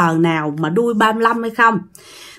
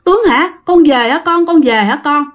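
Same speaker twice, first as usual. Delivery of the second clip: higher-pitched, eager questions in a retold mother's voice; lifted, urgent joy, lightly acted; overjoyed mother; 6 semitones higher than usual. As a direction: act out a mother's joyful disbelief at her son's return. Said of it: "mừng lắm".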